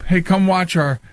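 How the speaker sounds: ordinary talk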